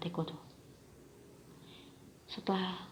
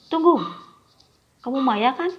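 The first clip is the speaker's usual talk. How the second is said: higher and louder call to stop her; 7 semitones higher, 10 dB louder than usual. Acted. Gary calls her back.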